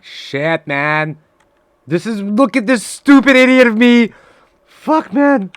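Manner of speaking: frustrated outburst. Real, not acted. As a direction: blow up at your own mistake. Self-mocking rage at bad trade.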